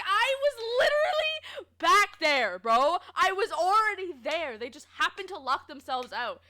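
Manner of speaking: loud, high, drawn-out mock outrage; indignant protest. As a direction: protest your innocence, starting big and easing off. Retelling protest to manager's scolding.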